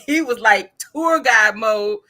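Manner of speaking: ordinary talk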